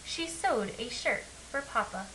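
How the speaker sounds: ordinary delivery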